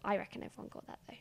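hushed aside, barely voiced; whispering. Real, not acted. Aside hidden from listeners.

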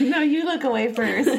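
giggling coy phone voice; giggly late night call. Pretend. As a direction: giggle it like a smitten teen. Lovers refusing to hang up.

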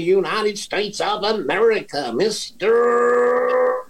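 slow, high, called-out introduction; drawn-out announcer call. Acted. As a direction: stretch the name like a ring announcer. Host calls out guest introduction.

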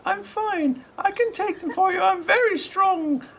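put-on very high child voice, brushing off the cough; eager kid trying to prove he's strong; little boy insisting. GM voicing the boy.

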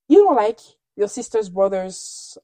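ordinary delivery

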